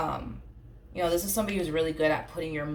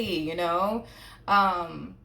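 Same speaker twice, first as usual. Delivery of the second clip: ordinary delivery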